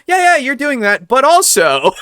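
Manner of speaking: high, loud eager interruption; eager interrupting. Retold or imitated. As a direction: jump in excitedly mid-turn. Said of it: "because I'm like".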